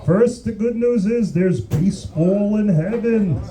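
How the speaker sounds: slow, wavering ghost voice; Ray Barone. Acted. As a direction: draw out each phrase like a spirit from beyond. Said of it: "I'm calling you from the afterlife".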